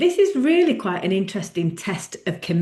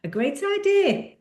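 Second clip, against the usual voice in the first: high-pitched burst of mock excitement; enthusiastic; 6 semitones higher, 4 dB quieter than usual. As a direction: gush as if thrilled by your own new idea. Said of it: "you feel all enthusi-, enthusiastic".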